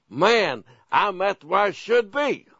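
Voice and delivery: very happy. Acted. Believer glad of their spiritual growth, very happy. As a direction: act out delighted satisfaction with yourself. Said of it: "Are you gonna be very happy".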